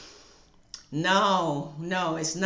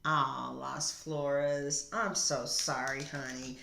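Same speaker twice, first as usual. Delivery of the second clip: soft apology; 4 semitones lower, 8 dB quieter, slower than usual. Real, not acted. Apologizing softly to a viewer.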